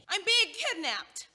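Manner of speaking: mock outrage, shouting for the neighbors; hammed-up cry for help. Trapped in the hallway, she hams up a kidnapping cry.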